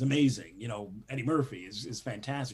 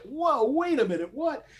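higher, rising into a stunned what; startled disbelief; 13 semitones higher, 5 dB louder than usual. Retold or imitated. Writer stunned as execs walk out, high surprised whoa. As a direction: caught off guard, voice jumps up. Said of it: "And you go like".

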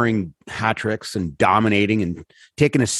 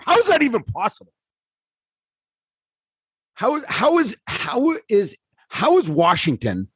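incredulous rant; 10 semitones higher than usual. Real, not acted. Ranting about the Capitals' defence.

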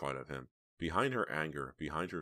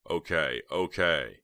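louder, irritated concession; annoyed; 7 dB louder, much slower than usual. A narrator's reading. Snowman gets up to leave, annoyed. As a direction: give in with annoyance. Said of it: "looking annoyed".

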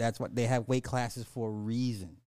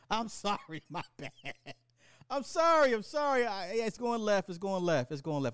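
high, laughing apology that settles down; giggly apology; 9 semitones higher than usual. Real, not acted. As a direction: squeal out the sorry, still giggling. Host apologizes, laughing at himself.

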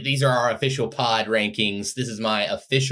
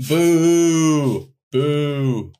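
booing; 7 semitones higher, 8 dB louder than usual. Real, not acted. Booing the worst-song pick.

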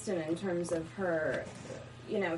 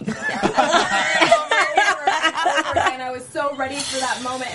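laughing; 6 semitones higher, 13 dB louder than usual. Real, not acted. Laughing about flubbing line.

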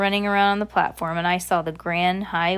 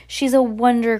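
higher, louder admiring cry; 5 semitones higher, 6 dB louder than usual. Acted. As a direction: exclaim it as an impressed crowd. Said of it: "cried the audience".

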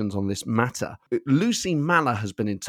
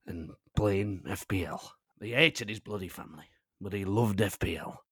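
a hint of a union general secretary; union boss; 2 semitones lower than usual. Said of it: "Maybe I was channeling one of our listeners".

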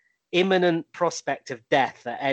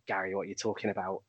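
low, quick, dismissive Keane; scornful Keane; 7 semitones lower, 10 dB quieter, faster than usual. Retold or imitated. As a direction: brush Gary off with quiet scorn. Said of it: "he's just like".

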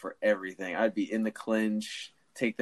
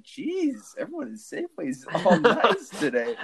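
high, laughing exclamation; amused disbelief; 11 semitones higher, 5 dB louder than usual. Retold or imitated. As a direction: laugh through it, amazed. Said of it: "And I was like".

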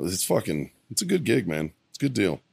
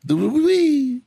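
gleeful squeal; 4 dB louder than usual. Acted. Gleeful jump exit sound.

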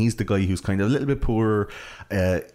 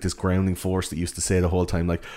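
usual speaking voice

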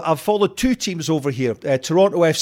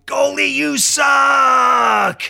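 high, long drawn-out fan shout; stretched-out imitation terrace yell; mock heckle; 8 semitones higher, much slower than usual. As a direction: holler it slowly across the pitch, imitating a heckler.

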